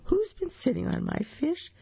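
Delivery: put-on very high little baby crocodile; tiny voice. A narrator's reading. Baby crocodile complains of squashed fish, tiny high voice. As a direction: squeak it out like a tiny creature. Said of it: "they heard a tiny voice say".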